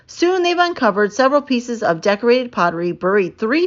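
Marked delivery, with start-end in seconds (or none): none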